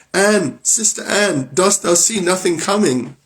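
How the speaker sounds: high, slow, drawn-out call to her sister; frightened wife calling up to sister Anne; anxious call. Acted. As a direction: call up the tower, hoping. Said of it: "she cried out".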